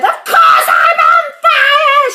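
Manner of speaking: high, indignant full-force yell; Grandma yelling. A narrator's reading. Grandma snapping back at George, indignant yelling. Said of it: "she yelled".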